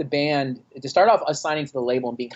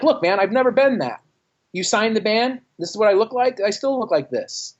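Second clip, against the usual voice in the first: much higher, indignant pushback; heated retort; 8 semitones higher than usual. Retold or imitated. Musician pushing back at the label boss about his image, indignant. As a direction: defend yourself, exasperated.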